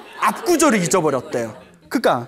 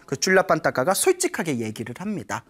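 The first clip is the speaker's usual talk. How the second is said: ordinary talk, nothing marked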